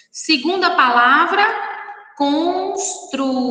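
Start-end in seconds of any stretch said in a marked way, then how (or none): 2.2-3.4 "construtor" slowly, in separate syllables. Shown as a demonstration. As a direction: sound out "construtor" piece by piece. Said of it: "na separação de sílabas".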